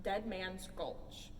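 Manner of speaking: plain reading voice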